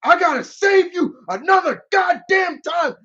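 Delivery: high-pitched mock shouted rant; hammed-up furious complaint, pitched right up; Mario. Mario ranting at Peach over saving her, high mock shout. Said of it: "he just goes on a rant with this".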